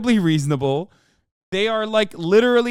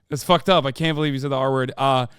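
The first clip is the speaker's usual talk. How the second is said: usual speaking voice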